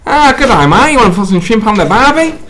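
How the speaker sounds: mock Australian lilt; put-on Australian accent. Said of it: "B, Australian".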